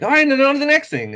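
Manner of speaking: high, loud, rushed mock announcement; brisk hurry-along. Voicing the story moving briskly on.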